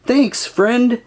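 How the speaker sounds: high, lightly called-out storybook line; understated captain's holler; mild yell. A narrator's reading. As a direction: call out as a grateful captain. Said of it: "yells Captain Pete".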